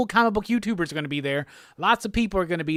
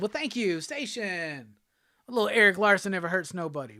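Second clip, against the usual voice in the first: ordinary talk, nothing marked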